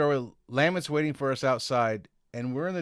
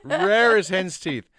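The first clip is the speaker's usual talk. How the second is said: slow, louder mock Scottish phrase; 7 semitones higher, 7 dB louder, slower than usual. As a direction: savour it in the driver's accent. Repeats the driver's phrase, savouring.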